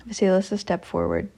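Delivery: usual speaking voice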